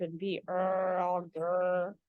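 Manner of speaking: low growly grumble of a sour grape. Retold or imitated. Grape mocking a grumpy sour self, low grumble.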